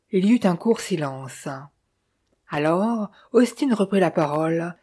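plain reading voice

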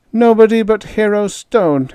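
high, loud bitter jab; bitterly. A narrator's reading. Kid mocking himself bitterly to Peter. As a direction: spit out the name with scorn. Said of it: "he said bitterly".

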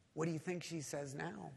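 ordinary talk, nothing marked

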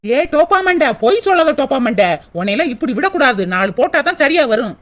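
gruff scolding man. Acted. The man scolding the liar, threatening to hit her, gruff low scold. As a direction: bark insults down at her.

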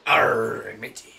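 slow hammed-up pirate voice; drawn-out mock pirate growl. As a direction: play a comic pirate, drawing out the words. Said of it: "I am a pirate".

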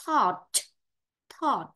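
"thought" with the final t fully released; full final sound. Shown as a demonstration. Teacher showing students not to swallow endings, careful final-t demo. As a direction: finish "thought" on a crisp audible t. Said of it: "âm đuôi là phải đọc triệt để".

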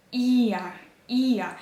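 «іа» with a long І, as if two І and one А; lengthened stressed vowel. Shown as a demonstration. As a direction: hold the І of «іа», then a short А. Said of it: "вони трішки довші".

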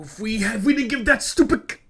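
high, loud angry burst cut off mid-word; frustrated outburst. Real, not acted. Player rants at the game over the lost scout book, high frustrated outburst. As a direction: blurt out your irritation, then catch yourself.

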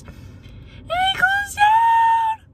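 high, slow wailing shout; anguished cry. Real, not acted. Telling viewers her favourite store closed, wailing shout. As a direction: cry out like the world ended. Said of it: "I'm fucking yelling because I'm so emotional".

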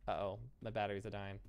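ordinary delivery

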